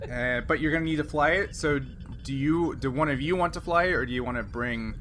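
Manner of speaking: ordinary talk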